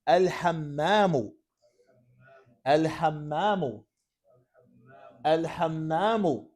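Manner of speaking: drawn-out, evenly repeated model word; slow dictation. Shown as a demonstration. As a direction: dictate it slowly so they can write. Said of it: "it's dictation in Arabic".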